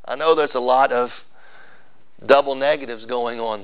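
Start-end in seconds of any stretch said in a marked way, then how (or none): none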